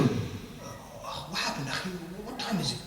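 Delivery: very soft, groggy questioning; dazed person coming round. Acted. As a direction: surface confused, voice barely there. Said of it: "the person comes up".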